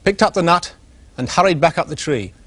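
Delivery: plain reading voice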